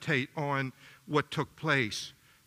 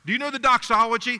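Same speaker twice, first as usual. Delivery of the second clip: excited; 7 semitones higher, 6 dB louder, faster than usual. Real, not acted. Excited question to congregation.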